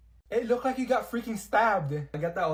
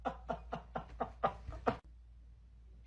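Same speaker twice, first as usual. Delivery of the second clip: slow, laughing through the name; 4 semitones lower, 7 dB quieter, much slower than usual. Real, not acted. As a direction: giggle the name out. Laughing at the delayed joke.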